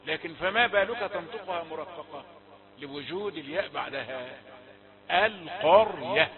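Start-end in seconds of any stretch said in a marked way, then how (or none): 5.1-6.3 القرية said slowly and louder, heavy ra; correct unforced reading. Shown as a demonstration. Teacher modelling the correct reading for students. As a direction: give القرية a full thick r, no strain. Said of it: "هكذا بدون تكلف".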